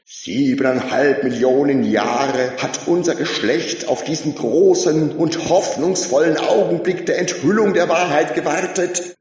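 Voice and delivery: high, loud cheerleader proclamation; rousing shout. A narrator's reading. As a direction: rouse the masses with every word. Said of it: "rief der Oberjubler".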